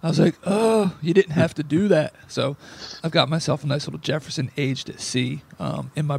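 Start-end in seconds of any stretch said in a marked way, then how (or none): none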